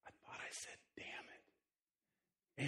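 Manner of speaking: whispered guilty confession. Retold or imitated. Five-year-old daughter confessing to dad in a whisper. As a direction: breathe it out like a secret. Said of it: "She said".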